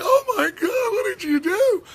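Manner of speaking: very high crying falsetto wail; hysterical media. Pretend. Media wailing at Trump's tweets, mock sobbing falsetto. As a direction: shriek it like a sobbing diva. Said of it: "the media's like".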